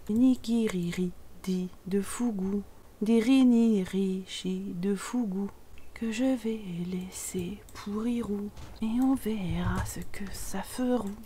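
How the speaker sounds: slow, soft mumbled incantation; low-volume, drawn-out mock spell; hushed incantation. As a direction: intone it quietly, syllable by syllable. Slow eerie chant over the food.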